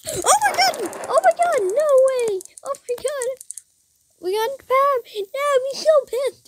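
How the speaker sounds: cheering your hero going to war; thrilled shrieking. Real, not acted. Thrilled by a big in-game moment.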